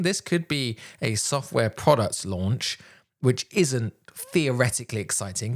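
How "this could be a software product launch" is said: ordinary talk, nothing marked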